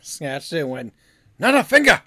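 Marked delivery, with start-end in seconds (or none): 1.4-2.0 high, very loud joke shout; hammed-up high-pitched holler; mock outburst. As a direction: yell it as a pretend tantrum. Listener acts out a snatching outburst.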